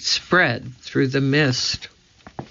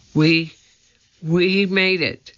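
shivering with chattering teeth; 5 semitones higher than usual. A narrator's reading. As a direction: stammer through cold teeth. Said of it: "Her teeth were chattering".

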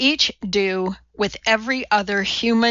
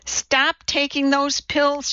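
firm, slow, raised-pitch command; demanding; 6 semitones higher, slower than usual. A narrator's reading. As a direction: order her to stop, slowly and firmly. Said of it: "she demanded".

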